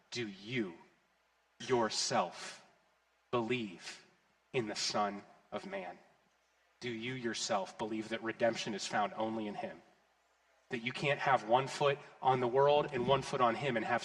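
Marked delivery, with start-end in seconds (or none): 0.1-9.1 soft, slow question with a pause after each word; quiet altar call. Acted. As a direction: lean in and ask it softly, word by word.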